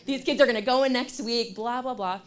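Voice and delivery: mock panic. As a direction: ham up the freakout. Teacher freaking out at home.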